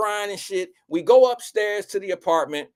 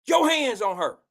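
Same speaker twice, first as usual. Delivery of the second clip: furious higher, louder mom shout; mother shouting; 7 semitones higher, 7 dB louder than usual. Retold or imitated. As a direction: yell the threat in his face. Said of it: "got to my face and was like".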